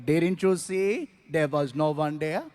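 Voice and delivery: low, calm, matter-of-fact driver; low unbothered driver calmly explaining; calm as can be. Retold or imitated. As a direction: say it like nothing happened. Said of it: "As calm as can be".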